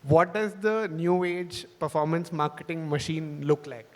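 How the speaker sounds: ordinary talk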